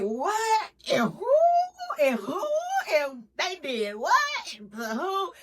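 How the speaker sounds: high, loud nosy squeal; mock homegirl hungry for tea; gossip shock. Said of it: "y'all know we be like".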